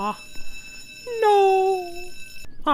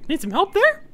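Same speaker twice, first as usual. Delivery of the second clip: normal talking voice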